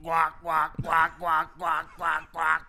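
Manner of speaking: quacking guac guac as a duck. Acted. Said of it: "What did the avocado sound like".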